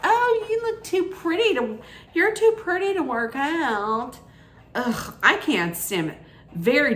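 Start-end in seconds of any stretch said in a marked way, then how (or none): 0.0-4.2 high, sugary mock-flirt greeting; flirty Brian imitation. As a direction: gush at them in a much higher put-on voice. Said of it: "he's all like".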